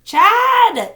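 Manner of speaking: loud call into the woods. A narrator's reading. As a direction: shout the name across the hills. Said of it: "she outed".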